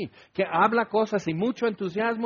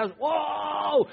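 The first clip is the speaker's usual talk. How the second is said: imitating a friend's fiery preaching, higher; a mock preacher's burst of fire; fiery preacher's cry; 9 semitones higher than usual. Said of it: "predicaba, pero así ardientemente". (imitando a un predicador ardiente, más agudo)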